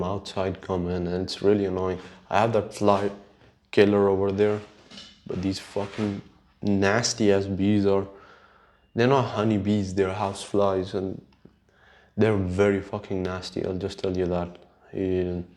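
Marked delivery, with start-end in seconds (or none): none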